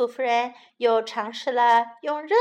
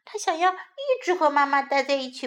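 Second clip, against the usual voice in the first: ordinary delivery, nothing marked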